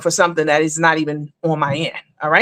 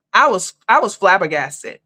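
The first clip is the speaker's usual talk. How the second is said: ordinary delivery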